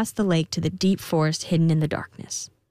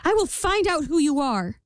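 high, resolute queen; determined queen; 9 semitones higher than usual. A narrator's reading. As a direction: promise it firmly. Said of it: "said the queen".

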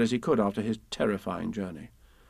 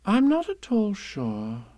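slow, high, musing mother voice; a lightly voiced thoughtful mother; thoughtful Kanga; 8 semitones higher, slower than usual. A narrator's reading. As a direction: ponder it aloud, drawing it out. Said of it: "said Kanga in a thoughtful voice".

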